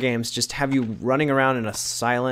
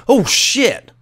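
startled curse; 10 semitones higher, 7 dB louder than usual. Real, not acted.

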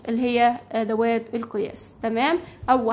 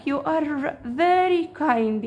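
very slow, clear English for learners; teacher voicing the girl's line, very slowly; slow read-aloud; 3 semitones higher, much slower than usual. Shown as a demonstration. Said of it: "يعني انت عطوف جدًا".

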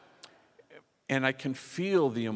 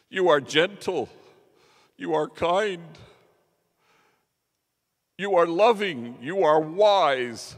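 through tears; 6 semitones higher, 9 dB louder than usual. Retold or imitated. The woman answering Mario, loud tearful praise. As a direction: plead through crying with fierce tenderness. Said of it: "through her tears she says".

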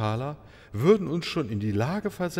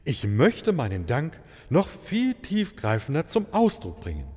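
usual speaking voice

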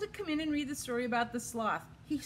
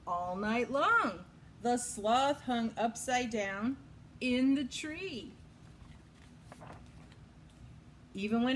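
lively picture-book storytelling; reading to children. A narrator's reading. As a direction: read it like story time for kids. Reads picture book aloud.

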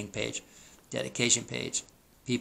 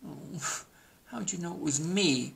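softer, higher dejected turkey groan; mock groan; 6 semitones higher, 5 dB quieter than usual. A narrator's reading. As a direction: groan it quietly like a found-out turkey. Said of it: "groaned Turkey".

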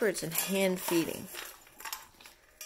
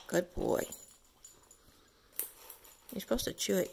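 ordinary delivery

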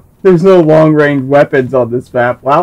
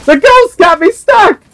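excited yelp; 4 dB louder than usual. Real, not acted. Stuck by ghost vehicle.

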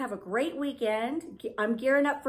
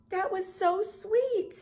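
high, slow, gushing coo; high slow coo over another sweet comment; 9 semitones higher, slower than usual. Retold or imitated. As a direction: stretch it out, melting. Quoting her reaction again.

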